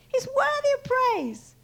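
high, slow, ringing declaration; stretched, emphatic preacher's praise; full-voiced proclamation. Shown as a demonstration. Proclamation repeated with more voice.

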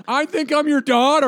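high, loud mock caller; hammed-up caller voice; mock phone message.

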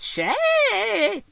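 shrill high squeal of surprise; surprised anime exclamation. Retold or imitated. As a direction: yelp it out, startled and loud. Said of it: "he goes".

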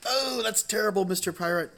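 high, gasped sympathy, played for the joke; hammed-up horror at a sad tale; mock dismay. Listener hears how pirate lost his hand, mock dismay.

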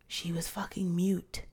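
whispered emphasis; whispering. Real, not acted. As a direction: whisper it for effect.

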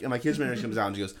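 ordinary talk

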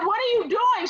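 high-pitched mock demand; indignant question. Daughter confronting mom, indignant. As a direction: ask it high in disbelief.

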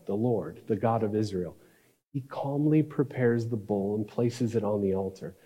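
usual speaking voice

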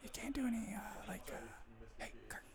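hushed, barely voiced whisper. Real, not acted. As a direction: whisper an aside to pull someone away. Pulling Kirk aside, whispering.

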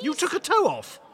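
very high-pitched, outraged protest; indignant outburst. Acted. Injured man accusing the cab driver, indignant. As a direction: squeal it in indignation.

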